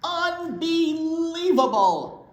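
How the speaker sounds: slow, loud exasperated bear; rumbling. Acted. Bear fed up with the mouse, slow and loud. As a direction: drag the word out in disbelief. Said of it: "rumbled Bear".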